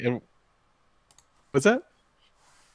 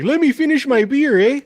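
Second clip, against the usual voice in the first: high, loud northwoods barfly holler; imitated shout from across the bar; Ricky; 12 semitones higher, 6 dB louder than usual. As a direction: protest like a drinker robbed of a pint. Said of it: "I heard Ricky behind me go".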